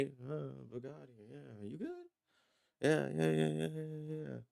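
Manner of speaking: very soft, limp, unexcited mumble; mock lukewarm reaction. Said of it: "you're not gonna see me".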